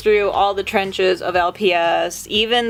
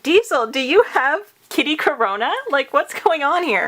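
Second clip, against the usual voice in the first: pet-owner fussing; 6 semitones higher than usual. Real, not acted. Questioning her sneezing cat.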